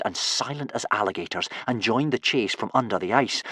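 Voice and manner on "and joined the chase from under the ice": plain reading voice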